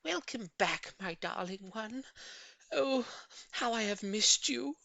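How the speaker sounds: joyful cry. A narrator's reading. Old lady welcoming her tortoise back, joyful high cry. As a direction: cry out with joy to a pet. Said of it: "clap her hands with joy and cry out".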